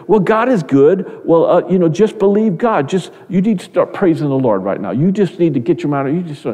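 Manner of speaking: high, preachy mock voice of a know-it-all; glib preachy advice. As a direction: imitate someone brushing off grief with slogans. Mocking glib religious advice.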